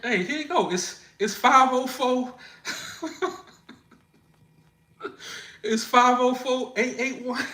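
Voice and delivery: high, laughing mock panic; playful high-pitched surrender; flustered compliance. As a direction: give it up as if held at gunpoint, comically. Acting startled handing over number.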